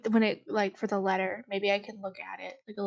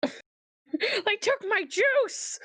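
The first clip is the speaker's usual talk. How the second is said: high, slow, hammed-up wail mocking the scream; mock scream parody; 12 semitones higher, much slower than usual. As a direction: parody the panic for a laugh. Player mocks the scream.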